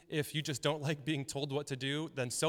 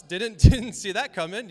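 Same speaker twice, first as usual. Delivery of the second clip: loud laughing retold reaction; 6 semitones higher, 14 dB louder than usual. Pastor retelling the moment he spotted the cop, laughing loudly. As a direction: laugh your way through the line.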